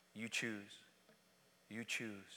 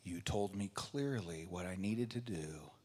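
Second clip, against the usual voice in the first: hushed prayer; 4 semitones lower, 3 dB quieter, slower than usual. Acted. Personal prayer of repentance.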